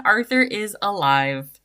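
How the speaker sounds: normal talking voice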